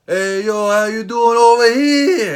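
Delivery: loud, high, slow hyped shout; hyped-up greeting. Retold or imitated. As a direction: greet him like a pumped-up wrestling fan. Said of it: "what did I say?".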